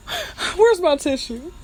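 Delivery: high, teary laughing voice; tearing up laughing. Real, not acted. Host hunts for a tissue, high and tearful with laughter. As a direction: squeal it through tears of laughter. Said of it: "I'm about to tear up".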